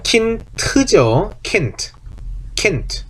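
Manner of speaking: "킨트" ending on a clear t, no voiced d; German Kind, final d devoiced. Shown as a demonstration. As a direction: say "킨트", d hardened to t. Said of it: "태처럼 발음이 된다".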